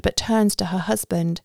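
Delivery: usual speaking voice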